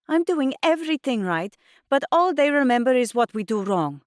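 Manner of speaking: high, loud frustration; heated complaint. A narrator's reading. Mum to daughter about gossip, frustrated. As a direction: argue it out, aggrieved.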